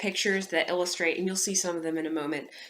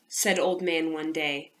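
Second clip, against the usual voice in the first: ordinary delivery, nothing marked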